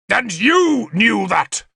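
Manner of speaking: high, slow accusing shout; shouted accusation. A narrator's reading. King turning his sword on the messenger, shouted. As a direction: hurl it straight at the culprit. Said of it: "He shouted the last four words".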